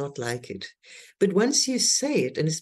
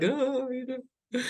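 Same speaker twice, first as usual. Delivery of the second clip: 1 abandoned sigh; 7 semitones higher, 5 dB quieter, much slower than usual. Retold or imitated. One left behind, hurt.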